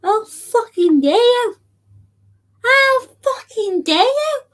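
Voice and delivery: high, outraged how dare you. Real, not acted. Streamer yelling at a hater in the comments, outraged. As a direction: throw the outrage at the hater, pitch climbing.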